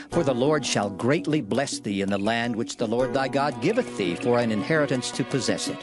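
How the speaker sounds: ordinary delivery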